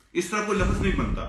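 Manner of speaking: usual speaking voice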